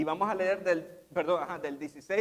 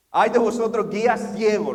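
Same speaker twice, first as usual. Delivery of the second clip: louder preaching rebuke; preached rebuke; 3 semitones higher, 8 dB louder than usual. Acted. Preacher voicing Jesus rebuking the Pharisees, loud. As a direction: thunder the woe at the Pharisees.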